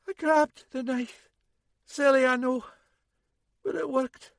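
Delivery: a hurt woman brushing it off; wounded woman, wry. A narrator's reading. Injured woman's voice.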